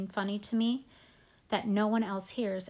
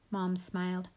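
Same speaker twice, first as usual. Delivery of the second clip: ordinary delivery, nothing marked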